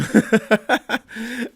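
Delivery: high, loud chuckle; amused laughter. Real, not acted. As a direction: laugh at your own joke. Laughs at own joke.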